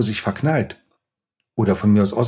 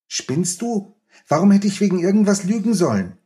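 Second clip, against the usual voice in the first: put-on much higher, outraged child's protest; indignant boy; 9 semitones higher than usual. Oskar protesting to Rico that he never lied, high and outraged. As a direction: throw the protest back, high and offended.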